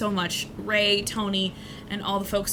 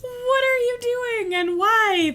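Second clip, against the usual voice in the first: much higher, louder and slow; incredulous; 13 semitones higher, 4 dB louder, slower than usual. Real, not acted. Streamer asking a donor why, slow high disbelief. Reacting to donor's odd amount.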